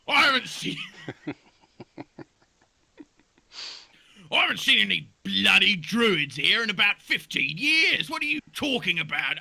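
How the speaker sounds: annoyed cartoon buck; high grumpy buck voice; squeaky buck. Buck answers irritably.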